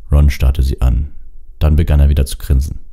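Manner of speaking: usual speaking voice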